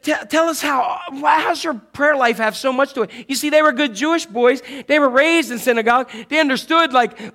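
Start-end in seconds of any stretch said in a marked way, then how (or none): 0.0-3.1 disciples pressing Jesus, voice pitched higher and louder; eager disciples. Acted. Disciples eagerly asking Jesus how he prays. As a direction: call it out like a curious student.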